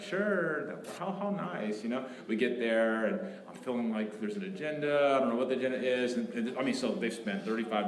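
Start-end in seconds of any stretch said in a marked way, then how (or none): 0.0-1.8 high, feigned delight; mock pleasant surprise. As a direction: act politely pleased. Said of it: "I'm like".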